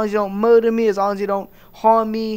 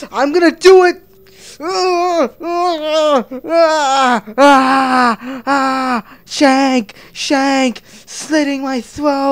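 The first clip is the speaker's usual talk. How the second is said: higher, loud hammed-up death cries; mock self-stabbing; 6 semitones higher, 6 dB louder, much slower than usual. Comedian acts out stabbing himself, loud mock cries. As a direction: scream like you're stabbing yourself.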